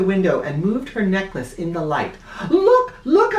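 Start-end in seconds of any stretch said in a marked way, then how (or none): 2.5-3.4 high excited yell; loud, high "Look, look". Acted. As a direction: shout it to the class, thrilled. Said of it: "I yelled".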